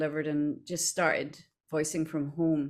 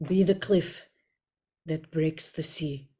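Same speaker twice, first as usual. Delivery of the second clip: lightly voiced game character, slow measured finish; Jahira; 3 semitones lower, slower than usual. Shown as a demonstration. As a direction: deliver it as a slow, solemn character line. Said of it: "one more Jahira line to Dan".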